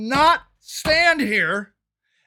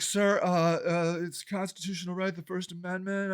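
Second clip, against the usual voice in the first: timid protest; 8 semitones lower, 11 dB quieter than usual. Retold or imitated. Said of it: "I'm like".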